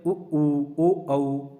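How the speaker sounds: उ ऊ ओ औ said with clearly rounded lips; rounded vowels. Shown as a demonstration. Teacher voices उ ऊ ओ औ to show rounded lips. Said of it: "अब इनका उच्चारण करिए".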